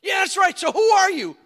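loud, high demanding question; a mock stern mom; stern mother. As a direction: press him for the answer.

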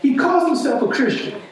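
a high, disbelieving radio host; mocking radio host. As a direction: sneer it like the outraged host. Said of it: "and she was just like".

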